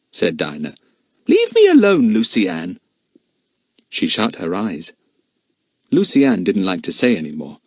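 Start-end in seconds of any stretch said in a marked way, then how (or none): 1.3-2.8 put-on snappy, irritable girl; fierce sleepy complaint; cross. A narrator's reading. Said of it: "fierce when she was cross".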